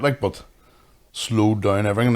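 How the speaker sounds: ordinary delivery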